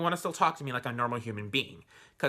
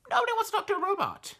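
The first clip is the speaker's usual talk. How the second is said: much higher, fast, mock-exasperated outburst; exasperated squeal; faster than usual. Complaining to herself about bot-filled dating apps, high fast exasperated cry. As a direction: blurt it out high and quick like you're fed up. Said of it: "And I'm going like".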